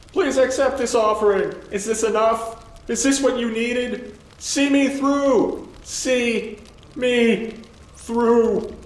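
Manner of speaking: high, loud, desperate pleading shriek; final scream. A narrator's reading. Burning farmer begging his god, screamed prayer. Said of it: "he unleashed one final scream".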